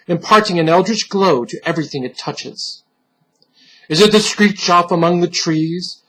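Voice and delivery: ordinary delivery, nothing marked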